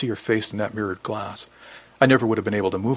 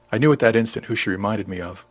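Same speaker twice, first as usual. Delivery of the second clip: plain reading voice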